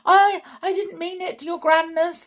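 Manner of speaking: high, loud, fast stammer; panicked cry. A narrator's reading. Witch begs her leader's pardon, panicked cry. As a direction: plead in a high frightened voice. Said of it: "the witch cried out".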